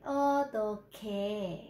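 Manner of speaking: Ô, tôi kề stretched very slowly, kề with a clear khờ; slow careful pronunciation. Shown as a demonstration. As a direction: drag out each syllable of Ô, tôi kề. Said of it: "phải âm khờ nha".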